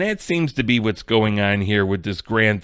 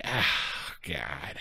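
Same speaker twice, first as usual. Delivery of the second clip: dismayed groan; 8 semitones lower, 11 dB quieter than usual. Acted. Acting dismay at the video.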